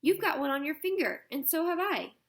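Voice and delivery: high, loud eager exclaiming; excited cry. Acted. Diggory shows the girl their matching rings, excited. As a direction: point it all out in a rush of excitement. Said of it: "cried Diggory".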